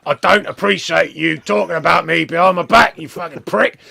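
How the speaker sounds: mock Australian tough guy; Australian accent. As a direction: snarl it like an angry Australian wrestler. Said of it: "Jeez, sounds Australian".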